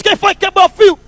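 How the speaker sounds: high, sharp demand from an angry doorman. Retold or imitated. Doorman demands who broke the filter, angrily. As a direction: bark the question like a furious church doorman. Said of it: "Nervoso, brabo".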